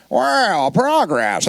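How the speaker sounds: imitating a stilted animatronic figure; creepy animatronic man. Animatronic host marvelling at progress, hammed-up creepy character voice. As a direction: put on a weird theme-park robot host. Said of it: "this is how he talks".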